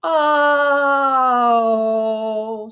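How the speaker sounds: "Oh" held long and sliding downward; bending pitch down. Shown as a demonstration. Teacher demonstrating a descending bend on oh. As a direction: let the sound slide down. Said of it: "make the pitch go down".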